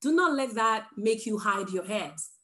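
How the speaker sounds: usual speaking voice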